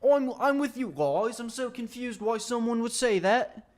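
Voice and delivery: high-pitched imitation British accent; mock British accent. YouTuber playing a confused prankster, mock British accent. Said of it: "imitating the British accent".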